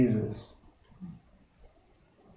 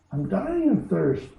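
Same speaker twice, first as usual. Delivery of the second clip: put-on thirsty young girl; 6 semitones higher than usual. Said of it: "said Jill".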